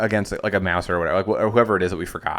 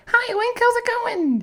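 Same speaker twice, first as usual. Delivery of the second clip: very high squeaky fairy voice; Sprite voice; 3 dB louder, slower than usual. Shown as a demonstration. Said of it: "Sprite's voice is like".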